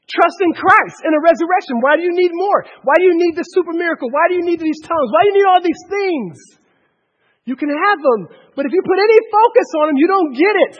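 high, loud volley of challenges; impassioned preaching. Real, not acted. Preacher challenging those who seek miracles and tongues, impassioned. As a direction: press each question on them forcefully.

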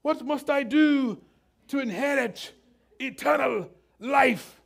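hammed-up British, slow and loud; slow, high, declaimed British accent. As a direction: pronounce it grandly in a put-on British accent. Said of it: "why the British came out of me".

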